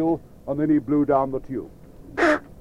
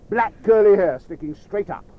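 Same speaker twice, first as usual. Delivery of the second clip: plain reading voice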